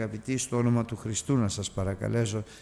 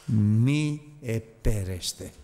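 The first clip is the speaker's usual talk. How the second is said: slow emphasis; 3 dB louder, much slower than usual. Shown as a demonstration. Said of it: "repeated scripture warning".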